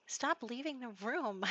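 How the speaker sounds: amused scold to the room-leaver; laughing plea. Real, not acted. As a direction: giggle while telling them off. Laughing at someone leaving room.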